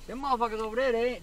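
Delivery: ordinary delivery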